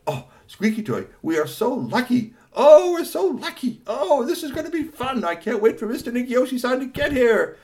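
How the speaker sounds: overjoyed high character voice; delighted squeal. Acted. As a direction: coo with joy at the robot. Gushing over robot.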